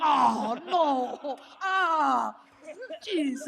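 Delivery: laughing dismay. Retold or imitated. Trip cancelled by covid, loud laughing dismay. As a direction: laugh it off in despair.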